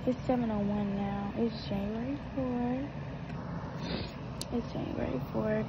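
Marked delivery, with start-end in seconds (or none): 1.4-4.2 slow drawn-out. Pretend. Repeats date, first take drawn out.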